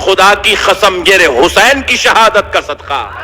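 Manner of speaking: high, impassioned preacher's cry; preaching at full force. Acted. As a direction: thunder it out to the congregation. Fiery sermon on Husain's martyrdom.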